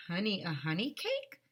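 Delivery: normal talking voice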